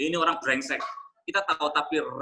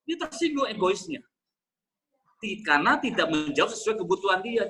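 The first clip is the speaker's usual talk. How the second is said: ordinary delivery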